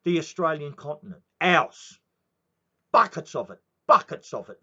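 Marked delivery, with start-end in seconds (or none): 1.4-4.6 much louder, passionate declaration; fierce shout. Real, not acted. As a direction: bellow it with pride. Patriotic rant about sacrifice.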